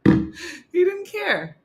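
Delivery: slow, high, laughing drawl; amused slow high line. Real, not acted. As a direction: stretch it out laughing. Laughing, drawn out.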